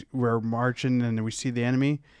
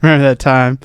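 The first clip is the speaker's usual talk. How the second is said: ordinary talk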